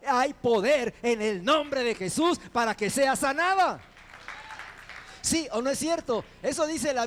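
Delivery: loud, rising preacher's proclamation; full-force preaching. Acted. Preacher roaring healing over the congregation, loud and fervent. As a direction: thunder the healing promise to the church.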